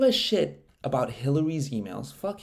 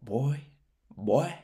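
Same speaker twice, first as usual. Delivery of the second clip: drawn-out mock twang; lightly hammed-up twang; crazy twang. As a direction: imitate his crazy twang. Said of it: "the craziest twang in his voice".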